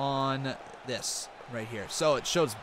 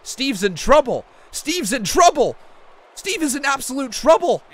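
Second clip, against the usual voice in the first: loud, excited ringside call; wrestling commentator; 8 semitones higher, 13 dB louder than usual. Acted. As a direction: call the match like a hyped announcer.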